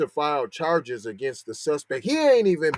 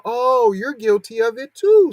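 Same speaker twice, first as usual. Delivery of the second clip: mocking retort; 8 semitones higher, 6 dB louder than usual. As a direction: toss the accusation back, mock-offended.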